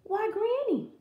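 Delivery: put-on high-pitched puzzled girl; startled little girl voice; surprised Little Red. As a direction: say it in wonder, high. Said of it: "she stopped in surprise".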